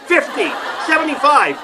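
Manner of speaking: high-pitched mock bidding; comic auction shout-out; auction bidder. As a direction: call out bids at an auction. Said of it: "would think there was an auction going on".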